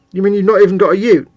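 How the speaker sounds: a terrible put-on Australian twang; mock Australian. Teasing an Australian viewer in a mock Australian accent. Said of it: "my Australian accent is terrible".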